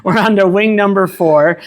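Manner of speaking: talking through a laugh. Real, not acted. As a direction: chuckle while announcing. Laughing through the spicy wings.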